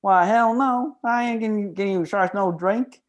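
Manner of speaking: high-pitched, indignant bartender; refusing bartender. Acted. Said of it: "the bartender replies".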